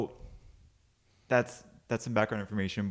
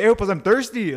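high, loud mock buddy voice; Javi impression; 9 semitones higher, 7 dB louder than usual. Buddy asking for soda, high put-on voice. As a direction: demand it in your friend's high voice. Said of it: "this dude actually talks like this".

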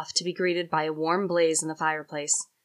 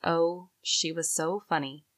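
ordinary delivery

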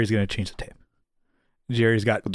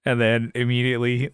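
ordinary delivery

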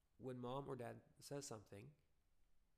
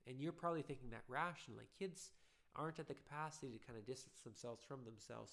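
ordinary talk

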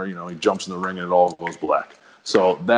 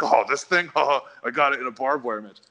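mock boastful, pitched up with a laugh; proud; 4 semitones higher than usual. Shown as a demonstration. How the wrestler did not answer about his scar, proud. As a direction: show off the scar, chuckling proudly. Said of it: "he didn't sound proud of it".

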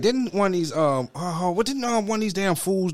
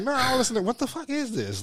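disgusted denial; 11 semitones higher than usual. Retold or imitated.

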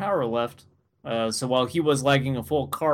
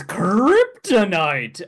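villain drawl; 4 semitones higher, 5 dB louder, much slower than usual. Retold or imitated. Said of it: "and he goes".

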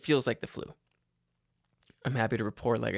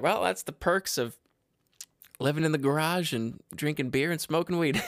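lightly voiced, higher-pitched quote; imitating friends' quip; 5 semitones higher than usual. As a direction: mimic friends joking, pitched up a little. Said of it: "they're like".

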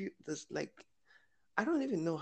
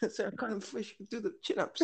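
put-on quick, higher-pitched stab at a New Zealand accent; attempted New Zealand accent; 7 semitones higher, faster than usual. Quoting the film line to a friend, amateur New Zealand accent. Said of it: "I don't know how to do the accent".

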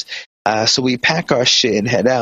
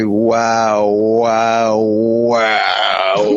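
mock wailing; much slower than usual. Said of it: "bitch exaggerating".

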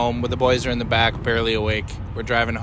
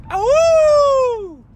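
high, loud wolf howl; 7 dB louder than usual. Acted. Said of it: "this boy becomes a wolf".

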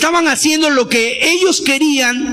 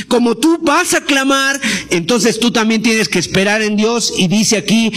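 ordinary talk

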